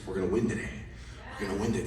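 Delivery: low, soft, intense vow; hushed pep talk. Retold or imitated. Dad psyching up his son before golf, hushed and intense. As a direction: keep it down and mean it.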